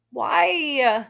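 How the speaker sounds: sighing, high drawn-out why; sigh, then wail it in disbelief; exasperated. Real, not acted. Host despairing at the reply.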